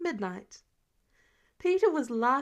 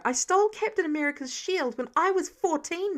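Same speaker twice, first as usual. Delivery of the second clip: raised, heated voice; louder defiant outburst; angry snap; 6 semitones higher, 5 dB louder than usual. Acted. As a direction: snap back in teenage anger. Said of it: "Peter snapped".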